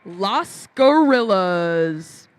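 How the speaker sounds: fighting game announcer. Acted. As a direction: boom the team name out slowly. Hyped team intro at robotics match.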